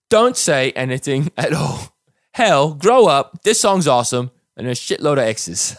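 high, loud hammed-up Cockney; Cockney accent. Reading Princess Delilah's online comment aloud, Cockney accent. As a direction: comment read as an outraged Cockney. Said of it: "an English Cockney accent".

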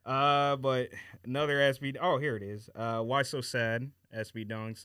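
usual speaking voice

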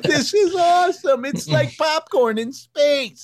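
put-on high, slow amazed child; awestruck kid. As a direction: marvel at it like a kid. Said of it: "I'd be like".

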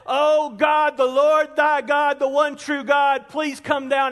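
calling out. Acted. Elijah calls on God for fire, calling out loudly. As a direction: cry out a prayer at full voice. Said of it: "he calls out and says".

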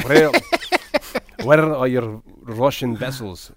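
slow, loud mock Russian accent; bad Russian accent. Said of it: "It sounds like a bad Russian accent".

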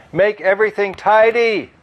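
loud, high yell. A narrator's reading. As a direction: shout the order at the swarm. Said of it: "yelled Chu".

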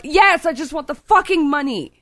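high, exasperated retold demand; pissed off. Customer demanding a refund from the cashier, pissed-off retort. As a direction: re-enact snapping at the clerk. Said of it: "I am so fucking pissed".

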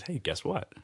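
imitating a playful friend, soft and high. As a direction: lean in softly with a playful setup. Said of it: "he's a little playful".

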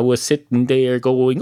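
usual speaking voice

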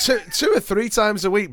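high, loud disbelieving repeat; incredulous mock boast. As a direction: scoff at the boast. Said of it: "mocking Basil's boast".

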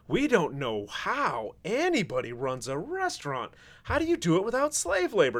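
high, hammed-up helpless wail; mock distress. Guys at Noma lament running restaurants, mock distraught. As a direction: plead in exaggerated bafflement, pitch swooping up. Mocking Noma's helpless plea.

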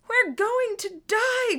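high, loud, drawn-out scream; panicked scream. A narrator's reading. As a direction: scream it as if death is coming. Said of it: "Gordon screamed".

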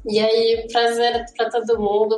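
usual speaking voice

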